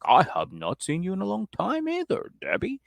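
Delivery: high mock-formal reply with a rising stretch; Tony. Tony answering Debbie's greeting, hammed-up high voice. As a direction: echo the greeting back in a put-on high voice. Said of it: "And Tony's like".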